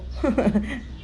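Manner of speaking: laugh. Real, not acted. Laughing at toothpick cake.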